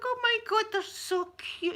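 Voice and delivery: very high squealing coo; high-pitched, besotted gush; gushing delight. Retold or imitated. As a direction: squeal over an adorable animal, drawing it out. Retells own reaction to kangaroos.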